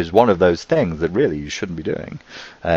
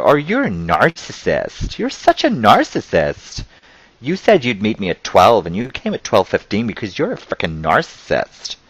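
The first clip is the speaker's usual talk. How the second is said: mocking accuser; 4 semitones higher than usual. Mimicking someone quick to call others narcissist, mock-accusing. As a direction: imitate an over-the-top, indignant complainer.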